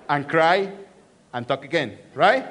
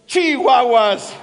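louder, slow exasperated Chihuahuas; frustrated exclamation; 3 semitones higher, 7 dB louder, slower than usual. Retold or imitated. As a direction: blurt it out when the remote battery dies. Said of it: "And I say".

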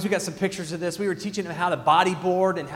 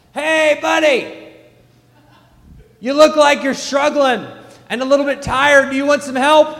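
loud high call over the waves; yelling; 10 semitones higher, 14 dB louder than usual. Retold or imitated. Father yelling to his son struggling in the waves. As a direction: shout across the water to the boy. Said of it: "so I'm yelling at him".

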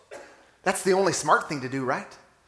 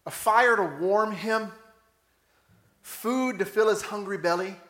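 ordinary talk

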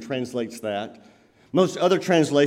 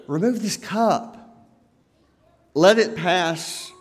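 slow earnest plea; slower than usual. Acted. Jesus asking God to remove the cup, slow earnest plea. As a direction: draw each word out in earnest prayer. Jesus repeats his garden prayer.

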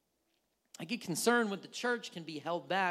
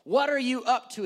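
loud, raised-pitch demand; all-caps shouting; 5 semitones higher, 10 dB louder than usual. Acted. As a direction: boom it out like a text in capitals. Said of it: "it's in all caps on text".

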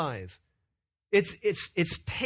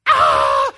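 loud desperate shout; preacher voicing anguish at missed church; anguished cry; 16 dB louder than usual. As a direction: cry out in loss. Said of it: "there ought to be this kind of".

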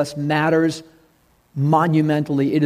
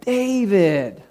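pleading soldiers; 6 semitones higher, slower than usual. Acted. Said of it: "And the soldiers are like".

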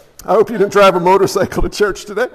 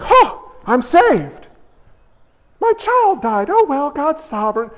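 glib believer shrugging off grief; mock complacency; 4 semitones higher than usual. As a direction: lightly parody a blithely pious churchgoer. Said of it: "People think if you're saved".

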